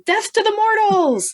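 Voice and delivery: high, slow monster scream. Retold or imitated. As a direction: shriek it like a Deadite. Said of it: "Deadites start screaming".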